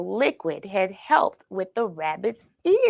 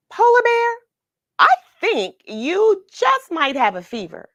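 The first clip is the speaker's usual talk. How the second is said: put-on high, louder caring girl; Rosie's voice; 10 semitones higher, 7 dB louder than usual. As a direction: tell the bear gently but clearly he's sick. Rosie addresses the polar bear.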